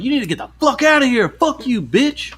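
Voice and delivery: high-pitched angry mock yell; imitated yelling. Stranger running the customer off, high mock yell. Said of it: "this guy starts just yelling at him".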